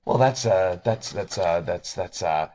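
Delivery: stammering, searching for an answer; hesitant pausing. Acted. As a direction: stall with repeated starts. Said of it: "kind of pauses and goes".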